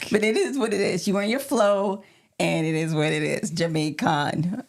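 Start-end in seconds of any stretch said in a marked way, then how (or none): 2.4-4.6 mock Jamaican accent. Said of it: "an accent".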